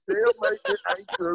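"Tell me it ain't true" jumps way up high in a hammed-up overwhelmed cry; comic disbelief, voice leaping high; mock emotional plea. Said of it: "I'm getting emotional".

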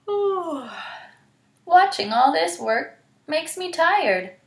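high, lazy yawning voice; sleepy Anansi. Acted. Anansi yawning at the working stick, high lazy voice. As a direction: stretch out the ooh like a yawn.

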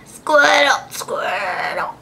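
creature name-call that fades away; Squirtle noise. Retold or imitated. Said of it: "I can't do that noise".

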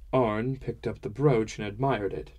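ordinary delivery, nothing marked

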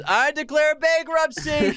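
loud, high, drawn-out bellow; imitated full-voice yell; mock scream. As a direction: shout it like a grand proclamation. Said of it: "he screams".

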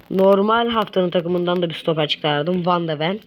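ordinary delivery

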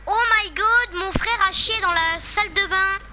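high, loud fake-shocked YouTuber; mock shocked thumbnail. Mocking a clickbait thumbnail, hammed-up shock. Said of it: "tu mets ta tête et tu dis".

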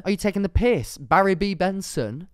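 loud, high, incredulous; loud mock outrage at a bee romance; exasperated outburst. Real, not acted. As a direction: demand it in disbelief.

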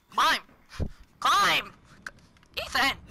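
exasperated shouted commands; impatient yell at a game character; urgent calling out. Real, not acted. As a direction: call out sharply in separate bursts. Ordering game character to climb.

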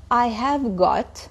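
drawn-out, careful model phrase; slow breakdown. Shown as a demonstration. Teacher breaking a phrase down for students, slowly. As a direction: stretch each word out for learners. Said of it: "خلوني افصل لكم اياها شوي شوي".

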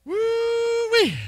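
long, very high exclamation; high "woo-wee" whoop. Real, not acted. Exclaims after complaint about noise.

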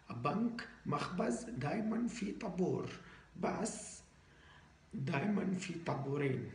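put-on foreigner speaking broken Arabic with an accent; Arabic with a Western foreign accent. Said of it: "إحنا لازم نحكي عربي مكسر".

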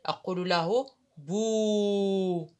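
بو drawn out very slowly as "bu"; teacher sounding a letter; ba with damma. Shown as a demonstration. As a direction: stretch بو slowly. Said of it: "والباء".

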